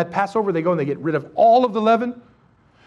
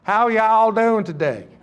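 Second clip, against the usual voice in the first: laughing mock Texan drawl "How y'all doing today?"; hammed-up southern Texan greeting, drawn out; slower than usual. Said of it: "that funny way of speaking".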